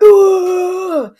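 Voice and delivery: high, loud mock class "ooh"; impressed ooh. The class impressed by their teacher, a loud high "ooh". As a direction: whoop like a whole impressed class. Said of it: "everyone's like".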